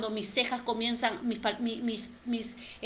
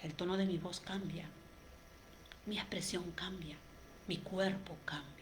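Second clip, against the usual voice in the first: voz triste y más baja, casi susurrada; apágala con tristeza; 10 dB quieter than usual. Shown as a demonstration. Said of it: "qué pasa cuando estoy triste". (tristeza demostrada en voz baja)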